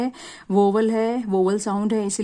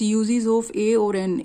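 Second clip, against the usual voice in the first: slow heading read; much slower than usual. Shown as a demonstration. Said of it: "आगे देखिए students".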